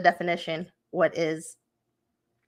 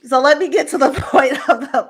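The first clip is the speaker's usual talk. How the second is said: louder, talking through a laugh after crying; laughing through tears; 6 semitones higher, 9 dB louder than usual. Real, not acted. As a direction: laugh it off and push on.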